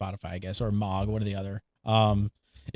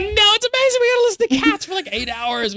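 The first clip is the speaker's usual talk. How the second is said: squealing high delight; excited self; faster than usual. Retold or imitated. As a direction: gush about it. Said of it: "And I'm like".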